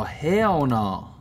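usual speaking voice

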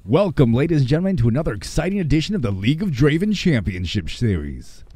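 announcer intro. Acted. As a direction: open the championship like a hype announcer.

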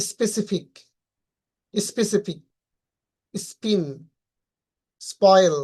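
"স্পেসিফিক, স্পিন, স্পয়েল" with plain P after S; unaspirated P. Shown as a demonstration. Teacher listing S-plus-P examples, unaspirated P. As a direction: separate words, no puff after SP in "স্পিন, স্পয়েল". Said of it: "non স্প্রাইডেড sound".

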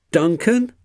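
high-pitched cross voice. A narrator's reading. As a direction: say the name in high annoyance. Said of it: "said Rusty crossly".

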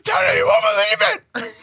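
imitating a child's loud, high call; mock excited kid running to tell dad. Kids greeting dad at the door, excited mock yell. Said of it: "I get home, they're like".

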